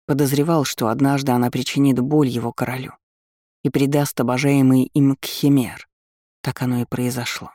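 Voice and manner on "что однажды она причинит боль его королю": ordinary delivery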